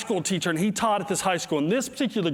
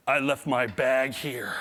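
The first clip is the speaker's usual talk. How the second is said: ordinary talk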